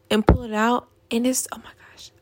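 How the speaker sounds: whispered in disgust; whispering, grossed out. Real, not acted. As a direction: hiss the words out under your breath in disgust. Disgusted rant about eye crust.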